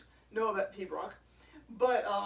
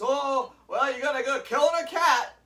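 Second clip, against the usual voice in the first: louder, taunting passer-by jab; mock jeer; 3 semitones higher, 10 dB louder than usual. Drummer mimicking people who mock passing pipers. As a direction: sneer like a heckler. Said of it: "somebody says".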